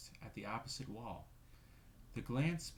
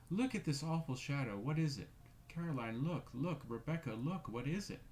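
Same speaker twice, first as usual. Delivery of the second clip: higher and louder terrified shriek; shrieking in fright; 5 semitones higher, 5 dB louder than usual. Acted. Missus Brigham shrieking to her sisters, louder and terrified. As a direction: cry out in horror, fuller this time. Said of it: "she shrieked out".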